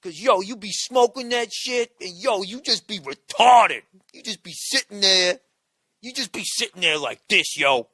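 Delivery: loud, dazed stoner mimic; stoner dude impression. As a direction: play a fried stoner bragging about his weed. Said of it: "he's like".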